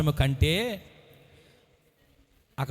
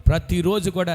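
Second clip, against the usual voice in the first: usual speaking voice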